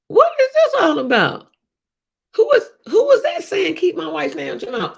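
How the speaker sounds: shocked. Retold or imitated. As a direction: blurt out total confusion. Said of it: "And I'm like".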